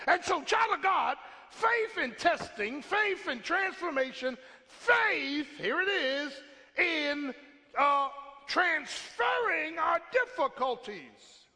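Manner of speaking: high, loud, slow climactic preaching; preaching at full force. Real, not acted. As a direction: build the sermon to its peak.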